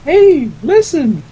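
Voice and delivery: very high, slow squeaky fairy. Retold or imitated. Navi impression after pixie talk.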